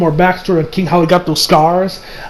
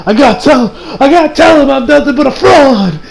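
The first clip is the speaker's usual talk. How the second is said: mock desperate confession; 6 semitones higher than usual. Acting out a panicked confession.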